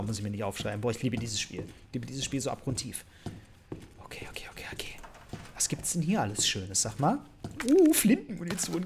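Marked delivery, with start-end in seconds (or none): 4.0-4.9 very soft, voiceless string of okays; whispered muttering. Real, not acted. Player talking himself through the game, whispering. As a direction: whisper under your breath. Muttering to self while playing.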